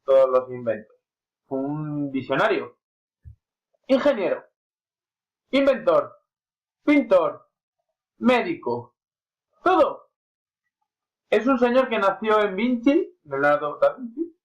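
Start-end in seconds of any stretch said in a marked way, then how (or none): 3.9-14.4 put-on a light, slipped-in Italian accent. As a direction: let a hint of an Italian accent creep into the name. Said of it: "me sale el acento italiano".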